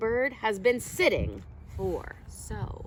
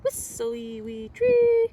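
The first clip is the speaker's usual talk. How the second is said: very high, loud whistly call; bright high birdsong voice for children; starling song; 12 semitones higher, 6 dB louder than usual. Acted. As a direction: sing it out like a bird on a pole. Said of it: "The starling sings from a metal pole".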